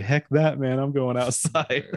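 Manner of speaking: amused mock defiance, tipping into laughter; funny-talking daughter. Daughter heading outside, imitated high and funny. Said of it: "man, she talks funny".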